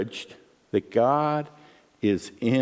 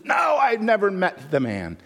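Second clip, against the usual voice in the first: Peter denying; 8 semitones higher than usual. Acted. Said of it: "He goes".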